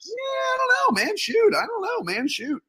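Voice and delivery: very high, squeaky hedging; high-pitched dodge. Real, not acted. Guest dodging the pimp question, high evasive voice. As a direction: wriggle out of it in a high voice.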